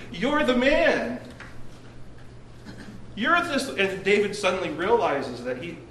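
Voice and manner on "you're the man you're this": slow, high, accusing punchline; dramatic reveal. Retold or imitated. Prophet Nathan confronting King David, slow and accusing. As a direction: land it slowly like a verdict.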